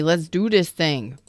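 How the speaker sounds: ordinary talk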